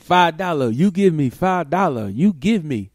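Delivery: put-on loud, high, pushy Colombian accented demand; mocking a Colombian beggar's demand. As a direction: demand it bluntly, broken English. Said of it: "These motherfuckers".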